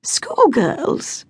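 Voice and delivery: high, sniffy dismissal; name them like a garden pest; cool scornful remark; disdainful. A narrator's reading. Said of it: "as if identifying a common type of garden pest".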